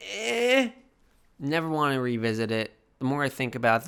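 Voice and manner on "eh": high, flat unimpressed eh. Retold or imitated. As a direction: shrug it off. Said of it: "I was like".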